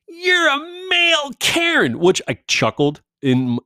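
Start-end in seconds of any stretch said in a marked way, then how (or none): 0.1-2.0 high, slow whiny yell; mock shrill yell. Mimicking the woman yelling male Karen, high and slow. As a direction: shriek it like her. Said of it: "I still hear her yelling".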